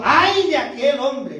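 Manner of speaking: drawn-out loud, high cry of woe. Acted. Jesus warns his betrayer before the twelve, crying out loudly. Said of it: "grita fuerte Jesús".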